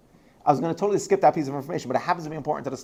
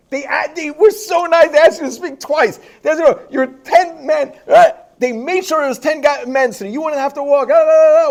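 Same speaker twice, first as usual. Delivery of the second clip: much higher, louder frantic self-scolding; cursing himself out; 12 semitones higher, 9 dB louder than usual. Retold or imitated. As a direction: berate yourself, running down the stairs. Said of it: "she heard me cursing myself out".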